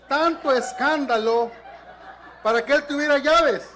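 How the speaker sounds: loud, high, laughing exclamation; laughing outburst. Real, not acted. Preacher to the congregation at the punchline, a loud laughing outcry. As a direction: belt out the punchline through laughter.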